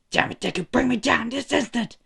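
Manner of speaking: high, strained acted yell; angry demand. Stuck bully ordering his rival to free him, angry.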